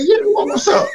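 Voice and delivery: high, laughing shout of mock surprise; laughing reply. To a nervous opponent, a high laughing shout. Retelling reply to a worried wrestler.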